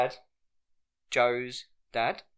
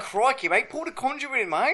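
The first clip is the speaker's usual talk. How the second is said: high-pitched put-on Aussie; jokey Australian accent; mock Australian; 9 semitones higher than usual. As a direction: play an Australian tradesman. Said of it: "like, Australian".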